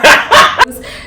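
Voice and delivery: short high airy laugh; breathy laugh. Real, not acted. Teller laughing at the weird pair, airy laugh. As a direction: let out a quick unvoiced laugh.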